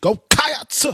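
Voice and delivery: "Cats" high and rising, split into two syllables; preacher-like Cats. Shown as a demonstration.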